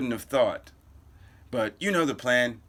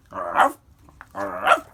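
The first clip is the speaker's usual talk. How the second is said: barking dog; 5 semitones lower, 7 dB louder than usual. Acted.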